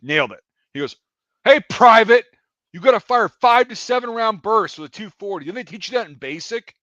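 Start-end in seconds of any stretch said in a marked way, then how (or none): 1.4-6.8 loud, higher-pitched mock reprimand; scolding callout. Range safety E-4 dressing down a private, scolding. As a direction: call out sharply, imitating a scolding superior.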